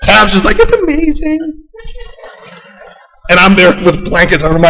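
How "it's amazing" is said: high, slow, sing-song delight; wife's dreamy optimism. Retold or imitated. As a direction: coo it like a starry-eyed wife. Wife cheerfully optimistic.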